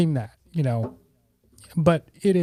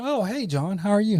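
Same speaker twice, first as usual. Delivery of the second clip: surprised greeting; 7 semitones higher than usual. Retold or imitated. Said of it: "I said".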